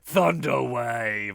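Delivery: slow, loud mock spell cast; dramatic spell call. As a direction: drag out the spell name. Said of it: "starting off going".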